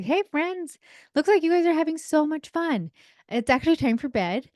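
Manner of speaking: high-pitched, friendly parent voice; friendly approach. Acted. Parent walking in on playing kids at bedtime, high friendly coaxing. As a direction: go up high and friendly to coax them. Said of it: "me walking in there like".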